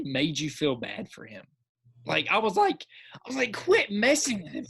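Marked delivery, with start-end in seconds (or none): 3.5-4.7 high, urgent mock protest; acted-out heckle at the screen; retold protest. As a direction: tell off the bullies in a raised pitch. Acting out pleading at the screen.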